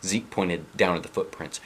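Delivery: ordinary delivery, nothing marked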